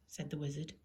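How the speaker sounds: usual speaking voice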